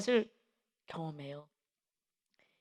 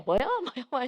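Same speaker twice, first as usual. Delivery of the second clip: hard-of-hearing mother's retold yell, laughing; mild mock yell; 2 semitones higher, 4 dB louder, faster than usual. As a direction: imitate a mother who can't hear, laughing. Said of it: "어머니 막 소리를 막 질러대고 안 들리니까". (웃음 섞어 흉내 낸 귀 어두운 어머니의 고함)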